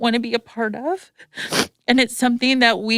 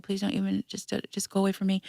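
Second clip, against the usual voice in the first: much softer, pleading the photographer to leave; pleading softly; 10 dB quieter than usual. Retold or imitated. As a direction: beg him quietly to go away. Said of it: "I'm like".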